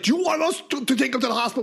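high-pitched exasperated question; frustrated. Retold or imitated. Ambulance guy turning to the friends, frustrated. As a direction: imitate a fed-up medic, voice jumping up. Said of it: "gets frustrated".

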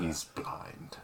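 whispering. Real, not acted. Aside about the old man.

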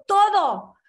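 high, loud insistence on every word; loud, firm rabbinic pronouncement; emphatic command. Retold or imitated. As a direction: drive the answer home like a rabbi. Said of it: "Le dijo".

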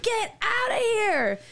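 high, drawn-out shout of disbelief; acted-out astonished yell; retold disbelief. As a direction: exclaim it like an amazed fan. Retold disbelieving reaction to the song.

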